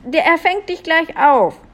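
high, encouraging call. A narrator's reading. Julia promises the prince a safe catch, calling up. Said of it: "rief Julia dem Prinzen zu".

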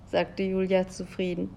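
ordinary delivery, nothing marked

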